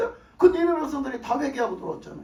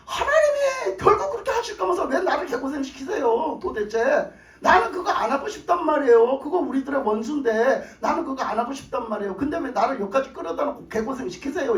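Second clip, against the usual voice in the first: Jonah shouts at God, half sobbing; Jonah's tearful protest. Acted. Jonah protests to God, crying out. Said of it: "요나가 그거 보라고 하나님".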